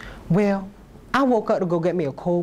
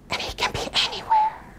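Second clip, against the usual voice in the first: whisper; 3 dB quieter than usual. Acted. Ominous aside.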